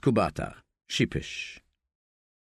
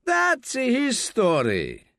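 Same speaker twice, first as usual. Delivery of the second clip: calling out; 11 semitones higher, much slower than usual. Acted. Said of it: "calls out".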